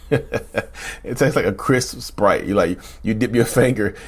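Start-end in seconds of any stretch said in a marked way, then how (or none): 0.1-2.4 slow, laughing through the words; can't keep a straight face; amused laugh while speaking. Real, not acted. Laughing at own joke.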